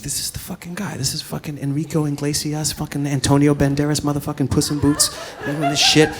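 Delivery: hissing, jealous aside; jealous muttered rant. Retold or imitated. Jealous inner rant about instructor.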